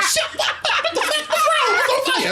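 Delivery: high, shrill-free startled shout of a woken mother. Retold or imitated. Son wakes his mother at night, startled high shout. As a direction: jolt awake yelling at him. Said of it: "she was like".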